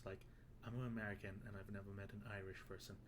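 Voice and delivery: ordinary talk, nothing marked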